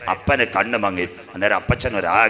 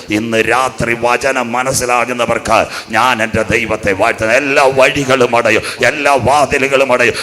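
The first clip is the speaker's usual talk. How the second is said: loud, raised revival-preacher delivery; full-force preaching; 3 semitones higher, 8 dB louder than usual. Real, not acted. Preacher promising God's protection to the congregation, full-force exhortation. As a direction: preach it out at full force, building to a shouted praise.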